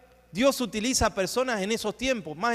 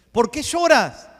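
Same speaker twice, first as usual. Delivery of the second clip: higher, louder repeat of the question; Jesus pressing the question; 7 semitones higher, 6 dB louder, slower than usual. Retold or imitated. Jesus presses Mary again, higher and louder. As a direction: lift it up and push the question harder. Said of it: "le dijo el Señor".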